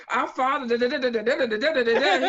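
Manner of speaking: loud, rattled-off mock prayer; mock frantic praying. Man praying for his ghost-hunting friends, loud mock-frantic prayer. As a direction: imitate desperate praying, babbling in place of words. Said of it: "he was praying his ass off for them".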